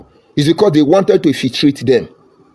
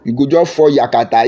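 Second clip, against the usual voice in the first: usual speaking voice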